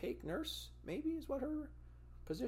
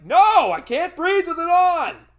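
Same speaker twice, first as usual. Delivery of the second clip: much higher and louder protest; desperate shout; 18 dB louder than usual. Retold or imitated. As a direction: yell that you can't breathe. Refusing the mask.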